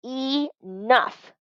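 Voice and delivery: high, firm command. Acted. Mother ordering the kids to stop, firm and high. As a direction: shut it down in one word. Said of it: "commanded my mom".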